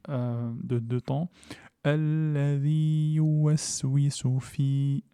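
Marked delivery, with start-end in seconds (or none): 1.8-5.0 slow chanted recitation; Quranic recitation. Shown as a demonstration. Teacher reciting the verse slowly for students. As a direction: intone Alladhi yuwaswisu fi in long tones.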